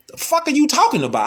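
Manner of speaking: high, fast, incredulous question; like the words made no sense; baffled outburst. Retold or imitated. Recalling a Southern dude's talk, high fast disbelief. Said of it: "I was like".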